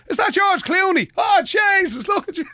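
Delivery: high-pitched astonished call across the street; mock passer-by's shout. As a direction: imitate an amazed onlooker calling over. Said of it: "did anybody shout over".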